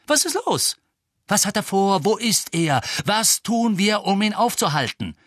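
high, loud, furious questioning; angry demanding. A narrator's reading. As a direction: demand answers at the top of your temper. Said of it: "sagte Harry zornig". (zornig und laut fordernd)

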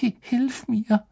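high, stammered plea for help; frightened high-pitched whimper; moaning plea. A narrator's reading. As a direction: beg a friend in a slow, helpless moan. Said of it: "stöhnte er Hermine zu".

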